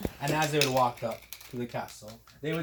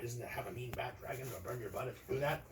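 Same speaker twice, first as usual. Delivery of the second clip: hushed warning; 4 semitones lower, 11 dB quieter, faster than usual. Acted. Friend warns quietly.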